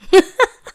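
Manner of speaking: short high, loud laugh; quick loud cackle of anticipation; excited cackle. Real, not acted. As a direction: burst of high-pitched giggling. Excited that it's party time.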